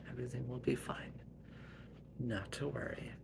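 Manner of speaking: quiet, gentle comfort; soft reassurance. Acted. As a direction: soothe her softly. Mother reassuring.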